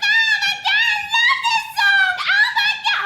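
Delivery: high, squealing fangirl shriek; excited squeal. Retold or imitated. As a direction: shriek it like a thrilled fan. Mom squealing over favorite song.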